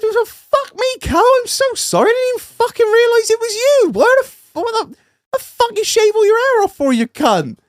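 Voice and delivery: high, fast, jokey mock abuse; mock matey banter. Greeting a mate he didn't recognise, jokey high banter. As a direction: rib him like an old pal, pitched right up.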